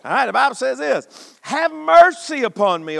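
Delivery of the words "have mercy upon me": loud, high, slow cry for mercy; a loud, high plea read from the Psalm; fervent plea. Acted. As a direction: cry out to God. Reading Psalm with fervor.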